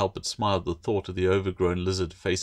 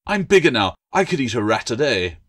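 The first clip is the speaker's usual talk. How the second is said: higher, louder boastful little lizard; bragging gecko; 4 semitones higher, 6 dB louder than usual. Acted. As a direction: puff up and show off.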